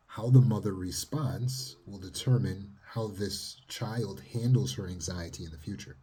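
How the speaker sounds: ordinary talk, nothing marked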